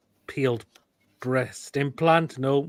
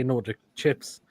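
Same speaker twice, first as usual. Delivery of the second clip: ordinary talk, nothing marked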